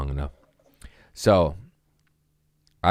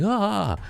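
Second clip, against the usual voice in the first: panicked gasp. Retold or imitated. Bookers panicking, very high.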